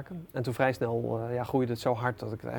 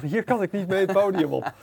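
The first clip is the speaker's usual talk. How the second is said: high, loud, comic dismay; mock panic; 7 semitones higher, 8 dB louder than usual. Organiser retelling his nightmare before going on stage, mock panic. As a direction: exclaim it like a retold nightmare panic.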